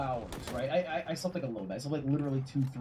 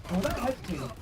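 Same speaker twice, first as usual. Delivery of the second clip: ordinary delivery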